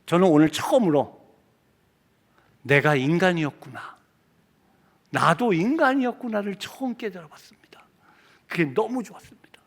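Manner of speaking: halting, soft near-whisper; moved, hushed confession. Retold or imitated. Leprosy patient realizing they are human, hushed and moved. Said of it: "한 분이 이렇게 대답하더라고요".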